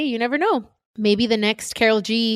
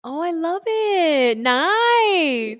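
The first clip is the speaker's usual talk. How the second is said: high, drawn-out coo of approval; delighted gushing; 9 semitones higher, much slower than usual. Real, not acted. Host reacting to her assistant's quote, gushing. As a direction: stretch the words out with delight.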